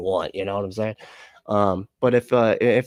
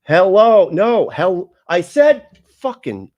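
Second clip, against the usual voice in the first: high, loud yelling at a phone; acted frustrated trucker yelling; exasperated shouting; 10 semitones higher, 11 dB louder, much slower than usual. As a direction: yell the word at dumb voice dictation. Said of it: "He's driving a truck, probably, so he's like".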